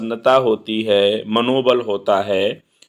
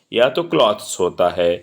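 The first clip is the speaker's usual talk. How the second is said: ordinary talk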